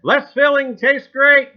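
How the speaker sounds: loud, high commercial jingle read; ad slogan. Acted. Host quoting a beer commercial, loud and high. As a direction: belt it like a TV beer ad.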